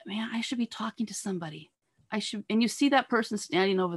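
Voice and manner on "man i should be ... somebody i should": quick, hushed thinking to oneself; inner thought. Acted. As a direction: murmur it softly, as a private thought. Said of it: "and you're thinking".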